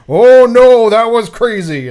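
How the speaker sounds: high, loud hammed-up exclamation; hammed-up surprise at a birthday prank; mock shock. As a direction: overplay being caught off guard. Retold victim reacting to the prank.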